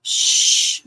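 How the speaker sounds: loud, drawn-out shush. Real, not acted. Shushing someone upset by a table bump, hushing. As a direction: hush them with a firm shh.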